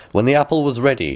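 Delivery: ordinary delivery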